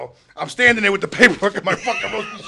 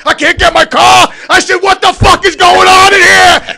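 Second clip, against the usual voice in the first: exasperated yell; 8 semitones higher, 9 dB louder than usual. Retold or imitated. Attacked by roosters at the gate, furious shout. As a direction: shout it in outrage.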